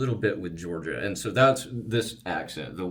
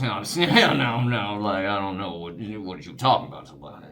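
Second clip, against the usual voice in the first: Shreveport accent. Shown as a demonstration. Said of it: "a little bit more complicated".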